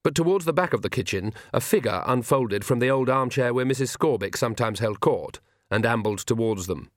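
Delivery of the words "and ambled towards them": plain reading voice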